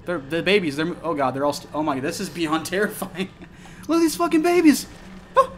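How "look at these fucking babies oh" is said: much higher and much louder, panicked; terrified shriek. Real, not acted. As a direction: shriek at the creepy dolls. Horror game dolls moving.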